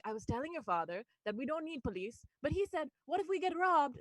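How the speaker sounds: lightly imitated Indian-accented mother; mom's Indian accent. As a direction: play mom reporting back in an Indian accent. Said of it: "It just hits different in an accent".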